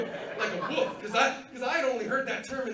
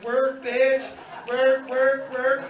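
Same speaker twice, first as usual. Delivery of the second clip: loud, punchy drag-show cheer; Drag Race queen; 2 semitones higher, 10 dB louder, much slower than usual. Retold or imitated. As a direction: belt each "work" like a drag queen egging someone on. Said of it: "I turned into an episode of Drag Race".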